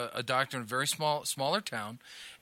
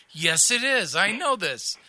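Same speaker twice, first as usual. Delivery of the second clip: mom's breezy reply; 5 semitones higher, 6 dB louder, slower than usual. Retold or imitated. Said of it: "She goes".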